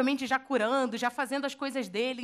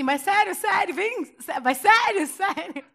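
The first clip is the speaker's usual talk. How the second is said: higher, louder eager disbelief; excited disbelief; 6 semitones higher, 5 dB louder, slower than usual. Retold or imitated. Pedro asking Jesus if it's really him, excited disbelief. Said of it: "Aí Pedro fala assim".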